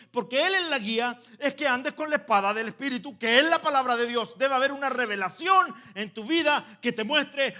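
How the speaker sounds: loud, high preaching burst; preaching at full force. Acted. Preacher scolding the church, full force. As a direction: preach it hard at the congregation.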